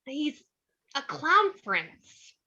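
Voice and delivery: halting, drawn-out answer with a smile; searching, then smiling. Acted. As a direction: grope for it, then land it slowly. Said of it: "Harley struggles for an answer, then smiles".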